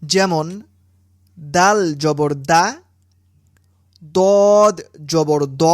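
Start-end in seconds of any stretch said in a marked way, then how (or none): 4.2-5.6 দদ জবর দ said slowly with rounded lips; rounded heavy dad. Shown as a demonstration. Teacher shows students দদ with a rounded mouth. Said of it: "মুখ গোল করে উচ্চারণ করতে হয়".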